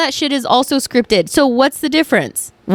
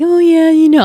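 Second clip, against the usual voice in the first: higher and louder, playing the keen fan; mock eager reply; 7 semitones higher, 5 dB louder than usual. To coworker, a pretend overeager fan reply. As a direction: gush the answer like an eager fan. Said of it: "not like".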